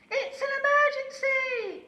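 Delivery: very high, loud alarm call; urgent shout. A narrator's reading. Lazy owl fakes an emergency to the horse, shouting. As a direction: sound the alarm in a panic. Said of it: "cried Aussie".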